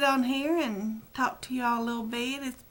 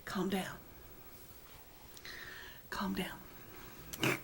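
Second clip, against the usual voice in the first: very soft, fading into a whisper; hushed self-soothing; 3 semitones lower, 16 dB quieter, much slower than usual. Real, not acted. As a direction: quietly talk yourself down, almost whispering. Soothing herself while squirming.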